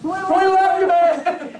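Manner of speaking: high-pitched mock yell to a friend. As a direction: holler it from the sidelines, hammed-up. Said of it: "and I yell at Glenn".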